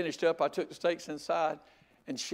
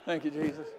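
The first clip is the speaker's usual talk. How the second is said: soft prayer; 5 dB quieter than usual. Retold or imitated. Recalling the tearful prayer.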